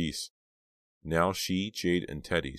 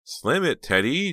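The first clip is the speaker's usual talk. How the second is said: high cheering scream; 12 semitones higher, 8 dB louder, slower than usual. Acted. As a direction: scream it from the bleachers. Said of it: "Jade screamed".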